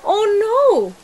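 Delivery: high, loud alarmed cry of "Oh no!"; guests crying out. A narrator's reading. Guests fleeing the vanished house, crying out in alarm. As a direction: shout it in sudden alarm. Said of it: "the guests cried out".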